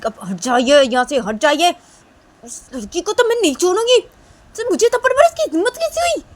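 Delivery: loud, very high angry protest; furious protest. Acted. Kajal protests to the principal, very high furious shouting. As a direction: push past the principal, raging. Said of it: "तो तभी काजल बोलती".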